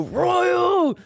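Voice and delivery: high, drawn-out call of a name; mock-exasperated falsetto call. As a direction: call the kid in a hammed-up high voice. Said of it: "because I go".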